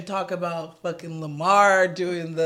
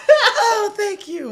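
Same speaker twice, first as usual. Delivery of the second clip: high-pitched, giggly gushing thanks; laughing imitation; 7 dB louder, much slower than usual. Host imitating the winning javelin thrower, high giggly thanks. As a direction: gush it high while laughing. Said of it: "He's like".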